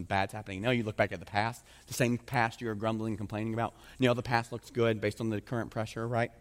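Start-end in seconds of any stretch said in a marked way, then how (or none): none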